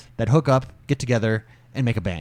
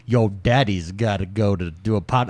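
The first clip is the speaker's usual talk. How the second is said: low, lightly put-on Southern twang; a hint of a trashy Southern accent; 7 semitones lower than usual. Dad to his daughter before the show, mild Southern accent. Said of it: "the trashiest Southern accent you could think of".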